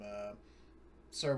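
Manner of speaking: normal talking voice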